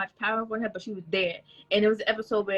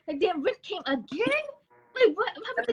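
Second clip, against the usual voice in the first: mock exasperation; 12 semitones higher, faster than usual. Retold annoyance at bills.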